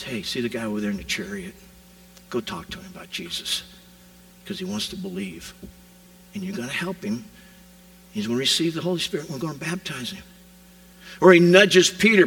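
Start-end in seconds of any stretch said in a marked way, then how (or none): 0.0-10.2 much softer, gently urging Philip; a quiet inner prompting, hushed and fast at first; soft nudge. Acted. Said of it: "He comes sometimes in a soft nudge".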